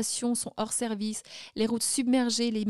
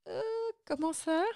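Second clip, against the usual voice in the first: perplexité rejouée; 6 semitones higher, 6 dB quieter than usual. Retold or imitated. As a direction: question hésitante, un peu plus aiguë et plus douce. Retold puzzled reply at airport. (perplexe, l'air de ne pas comprendre)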